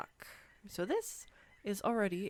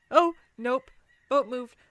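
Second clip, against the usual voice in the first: loud sudden yelp of alarm; real fright; startled yelp; 4 semitones higher, 11 dB louder than usual. Real, not acted. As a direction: yelp as the boat lurches. Boat wobbles as they step.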